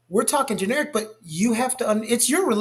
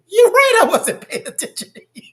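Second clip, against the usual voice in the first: sudden high, loud "You're right" then conceding; loud concession; 12 semitones higher, 7 dB louder than usual. Retold or imitated. Husband admitting fault to his wife, loud and high. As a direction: blurt out the admission, then give in.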